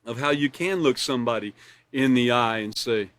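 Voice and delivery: ordinary talk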